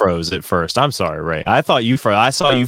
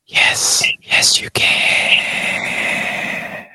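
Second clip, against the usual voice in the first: hushed whisper into the mic; much slower than usual. Real, not acted. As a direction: whisper it, barely voiced. Talking without headphones, unvoiced.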